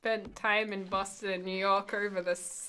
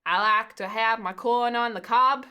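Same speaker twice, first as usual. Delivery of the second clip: louder, hammed-up American Southern accent; mock southern accent; 2 semitones higher, 7 dB louder than usual. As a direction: play a Southern cook in a clumsy accent. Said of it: "try and do a southern accent".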